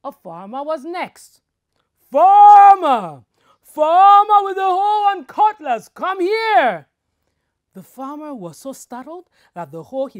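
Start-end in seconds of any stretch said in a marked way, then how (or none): 2.1-6.9 loud call. Acted. Tree calls the farmer.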